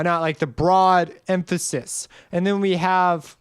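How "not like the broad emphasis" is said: usual speaking voice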